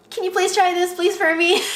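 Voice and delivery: high, loud, wheedling plea; begging sister. Retold or imitated. Sister begging bride to try the satin dress, mock pleading.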